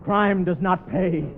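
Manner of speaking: slow, weighty gangster last words; slow solemn moral. Acted. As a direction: drag out the final moral.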